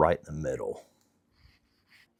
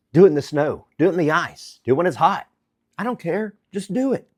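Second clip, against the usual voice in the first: excited pep talk; 9 semitones higher than usual. Real, not acted.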